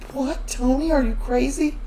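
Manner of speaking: high-pitched retold outrage; imitated disbelief, pitched right up; mock incredulity. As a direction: protest as if he has lost his mind. Retold alarm at losing the wine.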